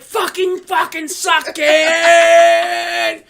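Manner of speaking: high shout with a long-held last word; hammed-up victory taunt; gloating yell. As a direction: scream it in triumph, dragging out the end. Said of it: "I'm like".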